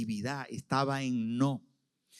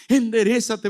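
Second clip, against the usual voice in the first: higher, louder urging; firm loud command; 9 semitones higher, 6 dB louder than usual. Acted. God to Saulo, a raised urgent command. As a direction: push it out as an urgent call.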